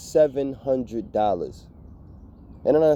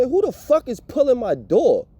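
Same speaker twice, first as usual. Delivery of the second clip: angry yell; 7 semitones higher, 4 dB louder than usual. Retold or imitated. Yelling at someone at his door.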